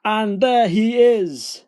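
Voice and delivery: thundering storybook giant; roaring giant. A narrator's reading. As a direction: bellow in triumph at spotting prey. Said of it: "roared the giant".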